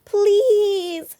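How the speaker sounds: very high, loud pleading cry; loud begging shout. A narrator's reading. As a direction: scream please for the whole lot to hear. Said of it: "shouted the cubs so loudly".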